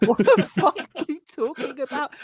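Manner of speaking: high, baffled retort through laughter; laughing retold disbelief; mock bewilderment. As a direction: imitate a confused friend, laughing as you do. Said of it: "he was like".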